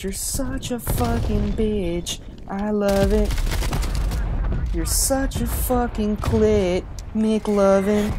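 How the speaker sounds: mock quote. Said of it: "He said".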